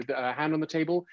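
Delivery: ordinary talk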